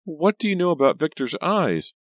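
high, loud suspicious question; suspicious demand. A narrator's reading. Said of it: "Pam demanded suspiciously".

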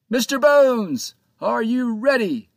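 high, loud, slow hail to the crow's nest; captain shouting up at the skeleton; shouting up the mast. A narrator's reading. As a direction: bellow it up to the lookout. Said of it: "Captain Joe shouted at the crow's nest".

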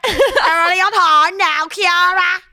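put-on high drunken insistence; drunk friend impression. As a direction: shout it like a tipsy mate insisting. Mimicking drunk friend at party.

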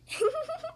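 high gleeful witch cackle. Acted. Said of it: "Peppa cackles".

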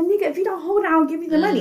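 high-pitched mock yell at moderate volume; retold screaming. Telling the tro driver to hold on, mock screaming. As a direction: push the voice up high, imitating a screamed retort. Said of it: "I'm screaming".

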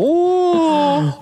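high squealing mock bugle, one breath; elk bugle imitation. Hunter imitating the bull answering his bugle, high mock bugle.

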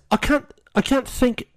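put-on high-pitched, halting British accent; badly faked British accent; attempted British accent. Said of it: "I can't do British".

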